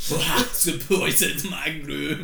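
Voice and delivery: mock olde Scottish. Friend echoes the mock line.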